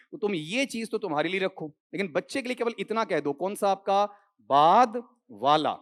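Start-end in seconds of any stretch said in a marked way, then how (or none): 4.5-5.8 बाद वाला said very slow with बाद louder; slow, emphatic बाद वाला; slow stressed answer. Shown as a demonstration. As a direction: drag out बाद वाला, leaning on बाद. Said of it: "ऐसा कहोगे".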